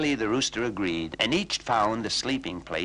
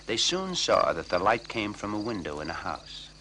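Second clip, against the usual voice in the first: usual speaking voice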